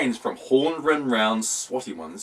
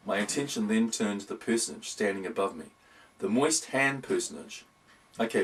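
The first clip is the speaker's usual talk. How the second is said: ordinary delivery, nothing marked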